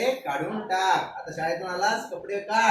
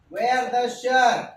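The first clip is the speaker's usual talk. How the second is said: very slow and louder; slow teaching drill; 3 semitones higher, 5 dB louder, much slower than usual. Shown as a demonstration. Teacher drilling the phrase slowly for pupils. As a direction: stretch each word for the class. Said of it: "काय?".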